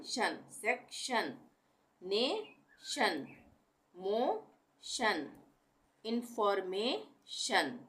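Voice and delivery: very slow, drawn out शन endings; tion as shun. Shown as a demonstration. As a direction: stretch each word, ending on a clear शन. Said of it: "टीआईओएन को हम शन पढ़ेंगे". (शन ध्वनि, बहुत धीरे खींचकर)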